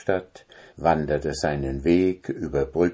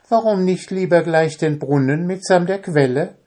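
high, louder swaggering question; boastful brag; 11 semitones higher, 4 dB louder, faster than usual. Acted. Boastful tailor mocking the giant's small errand. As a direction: brag like a show-off. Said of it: "fragte der Prahlhans".

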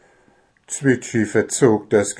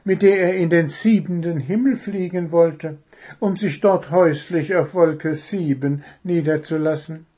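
higher-pitched storytelling bird voice; high little bird, lively and wordy; chattering little bird; 7 semitones higher than usual. Acted. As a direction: play a small bird lost in a tale, then caught short.

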